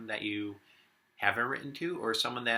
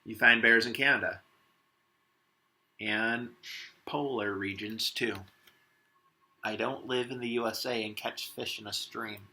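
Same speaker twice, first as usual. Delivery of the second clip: lively picture-book read-aloud as Warren the wombat; reading to children. Acted. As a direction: read it to the class with a touch of Aussie flair. Teacher reads picture book aloud.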